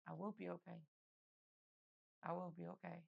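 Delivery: soft, hushed, holding back tears; quiet tearful reassurance. Retold or imitated. To his worried mother, a hushed tearful promise. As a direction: barely get the words out.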